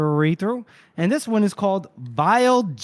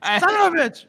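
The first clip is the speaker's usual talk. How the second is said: mock outburst; 11 semitones higher than usual. Mock outburst after teasing.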